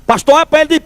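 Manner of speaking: usual speaking voice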